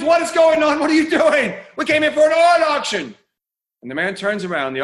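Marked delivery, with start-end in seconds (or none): 0.0-3.2 much higher, louder outraged crowd; crowd screaming. Acted. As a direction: yell it as an indignant mob. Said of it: "Everyone screams".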